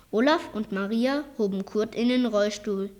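plain reading voice

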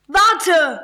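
high call to stop. A narrator's reading. Julius calling after fleeing friends, a high shout. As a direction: call after them, pitch jumping up. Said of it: "rief Julius".

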